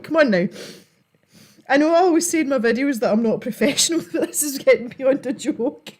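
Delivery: ordinary talk, nothing marked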